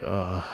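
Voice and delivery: low, quiet disgusted "ugh"; ungrateful groan. Retold or imitated. Looking at dad's old car, soft ungrateful groan. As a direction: groan softly, unimpressed by the gift. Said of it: "super ungrateful of me".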